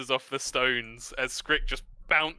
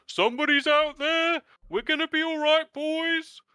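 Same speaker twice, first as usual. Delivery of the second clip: high, loud shout through the walls; muffled shout from inside; 5 dB louder than usual. Acted. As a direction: yell like a man shut inside a house. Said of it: "the muffled voice from inside".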